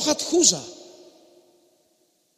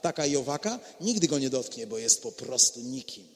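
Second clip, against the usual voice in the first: lower, soft self-satisfied boast; smug boasting; 9 semitones lower, 8 dB quieter than usual. Acted. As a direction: preen quietly over the neighbour. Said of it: "Bo byś mówiła".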